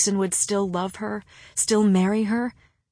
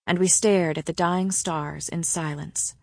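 ordinary delivery, nothing marked

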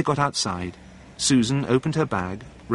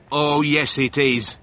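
higher, raised-voice retort; mild shout; 4 semitones higher, 4 dB louder, slower than usual. A narrator's reading. Steve argues back at John, mildly shouting. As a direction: contradict him with a restrained shout. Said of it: "shouted Steve".

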